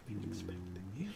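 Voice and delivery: fast, breathy sinister whisper; eerie whisper. Acted. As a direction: whisper it like a ghostly host. Faceless figures greet the party.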